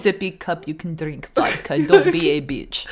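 great-grandpa's put-on Russian accent; blunt old-man orders in a hard Russian accent. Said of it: "such a hard Russian accent".

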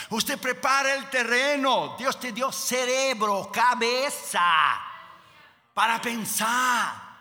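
real pulpit fervor, slow and stretched; emphatic preaching. Real, not acted.

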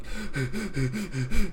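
imitated rhythmic huffing; heavy lifting breaths. Gym-goer lifting heavy, mimed huffing. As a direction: puff hard, mimicking a heavy lift. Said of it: "lifting heavy, and I'm like".

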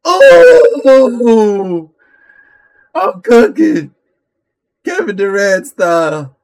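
high, loud bragging yell; excited shout. Real, not acted. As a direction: hype yourself up loudly. Hyped after scoring.

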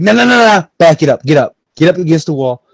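loud, high burst of orders; play-acted hostage-taker. Robber stopping a victim in pretend hold-up, urgent shouting. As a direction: shout them back against the wall, in character. Acting out an armed robbery scene.

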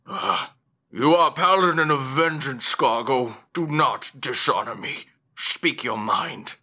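loud, imposing proclamation, slowing at the end; commanding deity. Acted. Hooded god to a kneeling paladin, commanding. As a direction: command with weight and authority.

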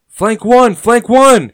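loud, high call that carries through chaos; shouting. A narrator's reading. Fighter calling to his companions through battle chaos, shouting. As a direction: shout it out high and full, twice. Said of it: "he distinctly shouts through the chaos".

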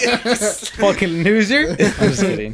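slow, high laughing insult; playful slow laughing jab; laughing taunt. Real, not acted. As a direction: drag it out through laughter. Teasing friend, laughing.

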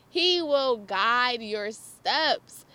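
very slow, firmly stressed words; drawn-out, insistent declaration; emphatic repeat. Real, not acted. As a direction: hammer the lesson home word by word.

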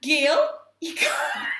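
slow, loud, laughing Liverpool shout; Liverpool accent. Retold or imitated. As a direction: bellow it slowly through laughter in a bad Liverpool accent. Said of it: "Can't do her accent".

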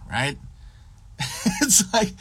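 ordinary talk, nothing marked

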